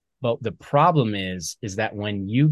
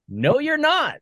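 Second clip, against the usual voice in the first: emphatic contradiction; 4 dB louder, slower than usual. Acted. Speaker answering a vet's "this is how I am", emphatic. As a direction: pitch leaping high in mock rebuttal. Acted rebuttal to veterans' excuse.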